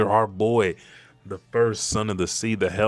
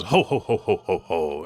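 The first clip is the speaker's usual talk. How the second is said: booming genie laugh. Acted. Luffy turned giant genie, booming mock laugh.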